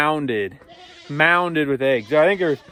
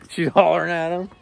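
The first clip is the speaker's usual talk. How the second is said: ordinary talk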